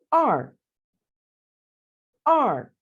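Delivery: teacher modelling आर in falling fourth tone. Shown as a demonstration. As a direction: say आर with a sharp falling stroke. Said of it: "tone का इस्तेमाल".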